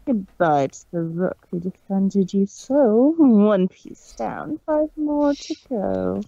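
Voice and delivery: gloating villain. Acted. Discord mocking the fallen rook, gloating. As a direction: savor each piece lost.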